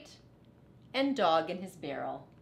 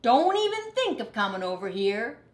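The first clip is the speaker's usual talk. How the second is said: louder, higher warning shout; 4 semitones higher, 7 dB louder than usual. Acted. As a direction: warn him off loudly.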